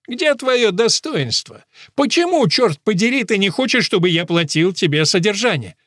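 high, loud, indignant questioning; exasperated. A narrator's reading. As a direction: demand an answer in frustration. Father quarrels with the mother.

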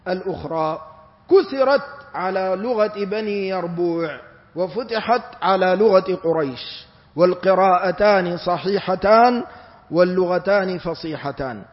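ordinary talk

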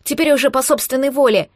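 ordinary delivery

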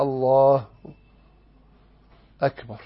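short breath pause between الله and أكبر. Shown as a demonstration. Teacher showing an allowed breath pause in the takbir. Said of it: "اتقطع نفسك وعايز تتنفس".

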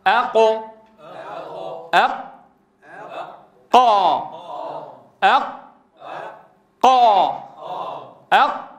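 أق, قا, قو drilled as hard voiced stops, slow and separate; qaf without breath. Shown as a demonstration. Teacher leading practice for repetition, breathless ringing ق in أق and قو. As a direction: strike each ق in قا and قو cleanly, no air. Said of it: "واضح صوت القاف من غير همس".

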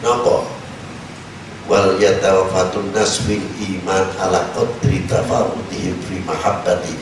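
usual speaking voice

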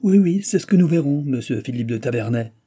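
high, loud shout easing onto the name; shouted retort. A narrator's reading. Viscount throws officer's name back at him, shouting. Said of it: "cria Jean".

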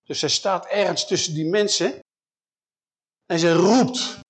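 ordinary talk, nothing marked

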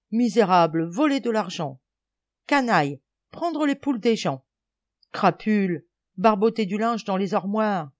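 loud, indignant accusations; mock-stern scolding. Boys playing policemen berating captured thieves, indignant scolding. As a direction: hurl accusations with rising force.

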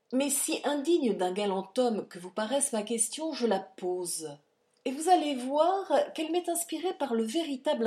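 plus aigu et plus fort, ironie mordante; cruelle ironie. A narrator's reading. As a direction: savourer chaque pointe contre elle. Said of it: "Le ton de cruelle ironie". (le comte raille sa femme avec une cruelle ironie)

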